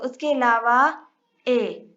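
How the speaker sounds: ordinary delivery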